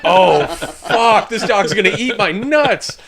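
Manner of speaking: laugh-panic at the dog; mock panic. Soldier laughs in mock panic at the dog, loud and high.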